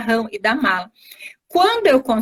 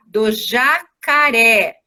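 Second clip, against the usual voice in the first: slow syllable demo; much slower than usual. Shown as a demonstration. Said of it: "vamos montar".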